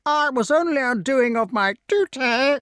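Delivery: high, loud whiny excuse; whining crow. A narrator's reading. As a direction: whine it defensively. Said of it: "whined the crow".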